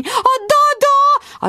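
very high, slow drawn-out squeal; panicked shriek. A narrator's reading. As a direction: wail it in alarm. Voni panics about babysitter.

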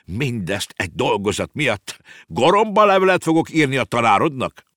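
indignant outburst rising high and loud; angry exclamation. Acted. Uncle to nephew about the homework, indignant shouting. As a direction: start contained, build to a shout. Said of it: "és így kiáltott".

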